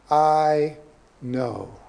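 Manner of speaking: "I know." said slowly, each word set apart; "I know." deliberate and falling, with a gap between the words; emphasis on "I know". Shown as a demonstration. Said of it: "the emphasis here is on the words".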